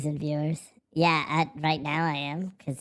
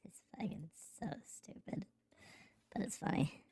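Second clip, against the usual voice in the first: unvoiced hushed whisper; 2 semitones higher, 16 dB quieter than usual. Real, not acted. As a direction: breathe it out in a whisper. Whispering aside to himself.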